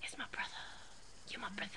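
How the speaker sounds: whispering. Real, not acted. Whispering correction next to sleeping brother.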